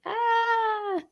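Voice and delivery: long, high held "aah" at normal volume; contained mock scream on one held note; imitated panic scream. Showing what the anxious brain is like, mock scream.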